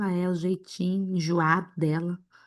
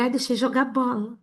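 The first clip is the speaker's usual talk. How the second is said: a higher, mock-guilty little excuse; sheepish excuse; 4 semitones higher than usual. Pastor imagining her weak excuse to God about her kids, mock sheepish. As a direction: play the lame excuse to God.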